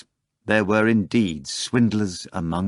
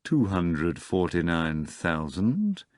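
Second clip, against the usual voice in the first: calm counting; slower than usual. Acted. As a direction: weigh each number slowly. Voland calmly counting the sum.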